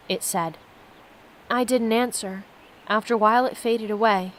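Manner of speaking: steady read-aloud narration of the game text; reading the story to viewers, lightly lifted. Acted. Streamer reads the game's story to viewers, steady narration.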